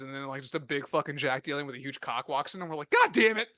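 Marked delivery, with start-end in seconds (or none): 2.9-3.5 mock outburst. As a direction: blow up in hammed-up despair. Abducted guys' outcry, retold.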